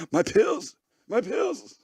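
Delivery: lightly voiced old man in distress; mock panicked grandpa. Host pretending to be the horrified grandfather, in mock panic.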